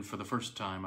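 plain reading voice

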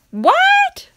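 very high startled yelp; totally blindsided; shocked what. Real, not acted. Reader shocked by book text.

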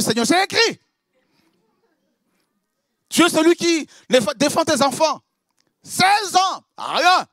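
high, loud, urgent demand to God; high, insistent plea for vindication; frantic prayer. Acted. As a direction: cry out for justice, voice climbing. Acted frantic prayer for justice.

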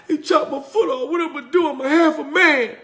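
high-pitched whiny complaint, a hint of self-pity; despairing Kunta. Kunta Kinte, foot cut off, lamenting in a high voice. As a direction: moan it like a man who lost his foot.

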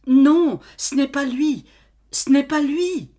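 high, loud desperate denial; vehement cry. Acted. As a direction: insist fiercely. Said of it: "Elle s'écria".

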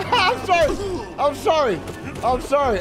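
laughing apology. Real, not acted. Laughing apology after failing on stream.

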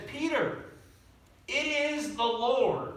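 a touch of awe as he realizes who it is; excited recognition. Retold or imitated. Disciple recognizes Jesus, retold.

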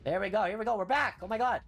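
high, loud announcement of a return; worked-up restart call. Real, not acted. Streamer starting the stage over, worked-up call.

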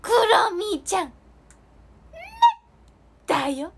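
high, loud cartoon mascot voice; My Melody. Mascot impression performed for viewers, high and loud character voice. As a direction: squeal it like a cute animated mascot. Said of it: "飛躍しすぎたマイメロちゃんのモノマネでした。".

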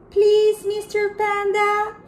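very high, loud pleading please; emphatic please. Acted. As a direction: beg with a big high please. Polite animal says please.

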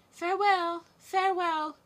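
high, loud calls of goodbye; crying farewell. Acted. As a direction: call after the departing carriage. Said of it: "cried the prince and princess".

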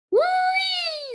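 very high, sustained whee; gleeful squeal. A narrator's reading. Baby Lincoln on the swerving ride, gleeful squeal. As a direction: squeal like a thrill rider.

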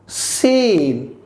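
সিন with a smooth long vowel; drawn-out, gentle. Shown as a demonstration. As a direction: ease through সিন, holding the vowel. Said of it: "একটু টেনে মোলায়েমভাবে বলবেন". (সিন টেনে মোলায়েমভাবে)

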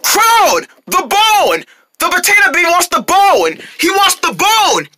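high, drawn-out urgent calls; urgent shouting. Acted. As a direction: call out frantically, stretching the last word. Urgent shouting to hand over bone.

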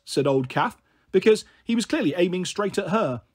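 usual speaking voice

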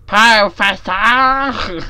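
loud, high, slow drawn-out Scottish call; Scottish accent. Retold or imitated. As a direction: bellow it in a hammed-up Scottish accent. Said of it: "You fucking Scottish".

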